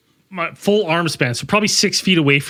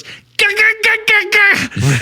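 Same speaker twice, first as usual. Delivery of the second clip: high babbling shouts; imitating a toddler; much slower than usual. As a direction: squeal like a small kid. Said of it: "Roman comes and he goes".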